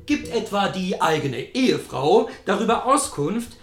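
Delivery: measured reading of a novel; story narration. Acted. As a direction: read the passage deliberately. Reads his own novel aloud.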